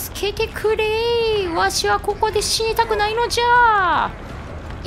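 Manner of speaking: put-on high, slow old grandpa whine; creaky wise old man. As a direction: wail like a doddering old man. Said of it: "ビルおじいちゃん".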